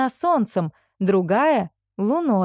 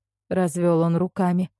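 plain reading voice

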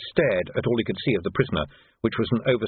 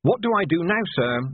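lifted, higher voice, unsure; anxious junior officer; 7 semitones higher than usual. Acted. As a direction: ask it like a nervous subordinate. Quoted question in narration.

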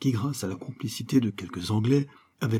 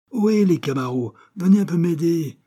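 high, loud hail to friends; calling out; 8 semitones higher, 7 dB louder than usual. A narrator's reading. Toto calls his mates to help him down. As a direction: call the mates over from up high.

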